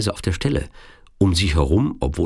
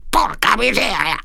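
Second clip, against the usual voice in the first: high-pitched villain swearing in Italian; cursing. A narrator's reading. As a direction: curse in a piercingly high villain voice. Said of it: "Der Sizilianer fluchte nochmal". (hoher Fluch des Sizilianers)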